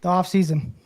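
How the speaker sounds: ordinary delivery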